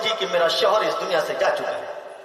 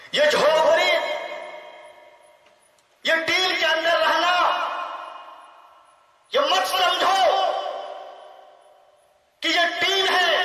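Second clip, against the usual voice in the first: fiery preaching; 6 semitones higher than usual. Real, not acted. Preacher rallies the students to honour the poor madrasa, fiery preaching. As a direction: rise into a rousing call to the students. Climax of the sermon.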